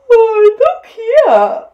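high, slow words through a big yawn; hammed-up sleepy koala. A narrator's reading. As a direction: yawn loudly as you speak. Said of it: "he yawned".

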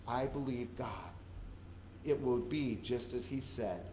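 soft, low, settled faith; quiet conviction. Acted. Preacher voicing a believer after answered prayer, quiet conviction. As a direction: say it softly, certain.

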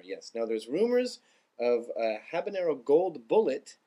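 ordinary talk, nothing marked

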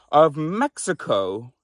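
ordinary talk

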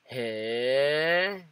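long drawn-out "Hey" sliding upward; "Hey" as a drawn-out questioning rise; rising intonation. Shown as a demonstration. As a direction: stretch "Hey" and swell into a rise at the end. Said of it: "the intonation can go up".

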